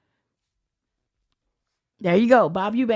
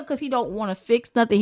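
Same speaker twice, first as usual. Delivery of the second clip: ordinary delivery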